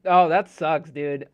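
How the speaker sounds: loud sympathetic groan; commiserating. Real, not acted. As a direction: feel bad for them out loud. Reacting to chat message.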